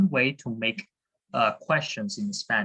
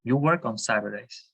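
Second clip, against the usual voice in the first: ordinary talk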